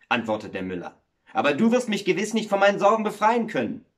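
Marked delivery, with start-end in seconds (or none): 1.3-3.9 raised, emphatic doubt; doubtful protest. Acted. Miller tells the water woman she cannot help, doubtful protest. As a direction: protest in a higher, insistent voice.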